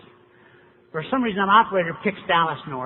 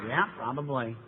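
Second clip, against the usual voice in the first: dry, softer and lower matter-of-fact reply; deadpan sponsor; 4 semitones lower, 7 dB quieter than usual. Retold or imitated. Sponsor calmly agrees the newcomer will be fired, deadpan. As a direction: shrug it off, unbothered.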